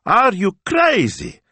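high, loud, drawn-out outrage; indignant cry. A narrator's reading. Young man rebuts the assistant's fear, indignant. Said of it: "cried Smilzo, indignant".

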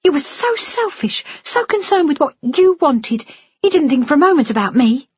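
very high, hurt outburst; furious daughter's high-pitched reproach; lashing out. A narrator's reading. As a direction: pile on the accusations, pitched right up. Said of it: "Jennifer lashed out".

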